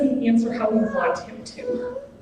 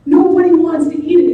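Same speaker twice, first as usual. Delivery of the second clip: emphatic preaching; 5 semitones higher, 9 dB louder, faster than usual. Real, not acted.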